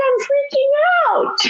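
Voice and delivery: very high, giggly mock panic; laughing panic. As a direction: squeal it between laughs. Retold meeting, laughing panic.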